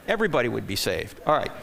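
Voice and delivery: usual speaking voice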